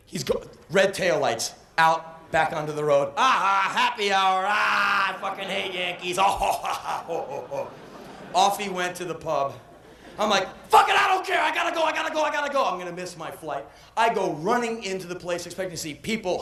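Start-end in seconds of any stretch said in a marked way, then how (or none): 3.2-7.7 gloating cabbie. Retold or imitated. Cabbie gloating after stiffing the tourist. 10.7-12.6 high shouted frantic repetition; panicked shout. Retold or imitated. Tourist racing to catch the flight, shouting.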